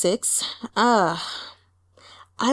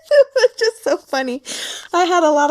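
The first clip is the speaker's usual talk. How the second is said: still laughing; 8 semitones higher, 4 dB louder than usual. Real, not acted.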